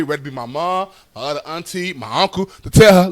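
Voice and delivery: normal talking voice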